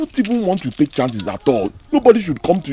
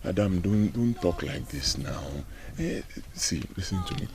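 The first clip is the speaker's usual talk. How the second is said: hushed coaxing; 8 semitones lower, 9 dB quieter than usual. Acted. Soothing frightened lover.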